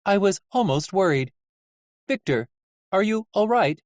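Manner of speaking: high, even character voice; mildly worried high-pitched nurse; concerned Nurse Ratched. Acted. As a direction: check on him in measured high tones. Nurse Ratched checks on Victor.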